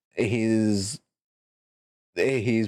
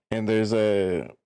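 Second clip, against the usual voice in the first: ordinary talk, nothing marked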